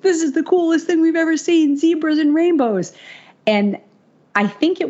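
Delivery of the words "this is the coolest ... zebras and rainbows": much higher mock squealing; freaking out. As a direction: gush like giddy friends in the back seat. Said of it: "they're all freaking out in the back seat".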